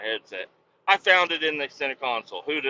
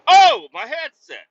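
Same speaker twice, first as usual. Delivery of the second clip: much higher and louder, drawn out with glee; excited joy; 14 semitones higher, 12 dB louder, slower than usual. Retold or imitated. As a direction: cry out, overjoyed at finding the lost item. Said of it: "I got so excited seeing my headset".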